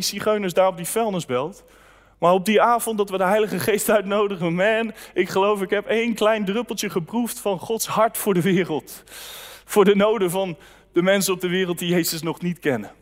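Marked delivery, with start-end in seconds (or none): none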